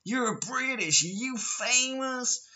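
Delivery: very high, stretched mock American accent; mock American tourist. Imitating tourists who think he is famous, mock American accent. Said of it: "They're like".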